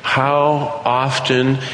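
usual speaking voice

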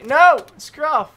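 high, loud shout at a dog; scolding a misbehaving dog; yell at dog. Acted. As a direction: snap the dog's name out. Yelling at a dog.

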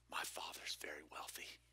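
secretive, hushed whisper. Retold or imitated. Preacher voices his boast to realtors.

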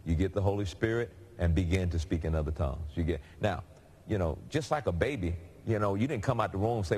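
usual speaking voice

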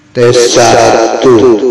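long echo tail on mic test; cathedral echo. Shown as a demonstration. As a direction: count into a huge reverb. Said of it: "ini cukup bagus kalau dipakai echo".

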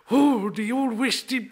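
put-on slow light Irish accent; hammy Irishman in New England. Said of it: "an Irishman who's living in New England".

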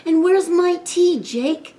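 high, loud demanding grandma; crying out. A narrator's reading. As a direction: call out sharply for service. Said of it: "cried Grandma".

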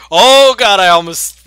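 high loud alarmed cry; startled. Real, not acted. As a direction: jump at the danger. Almost walked into lava.

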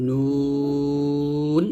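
নুন stretched very long; held nun. Shown as a demonstration. Teacher modelling the letter, drawn out. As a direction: draw নুন out slowly. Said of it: "নুনটা উচ্চারণ করতে হবে".

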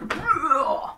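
high pained grunt; as if landing belly-first on a branch; winded grunt. Retold or imitated. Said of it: "his stomach's like".

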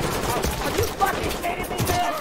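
high, loud outburst of disbelief; exasperated yell. Real, not acted. Sudden setback during live game.

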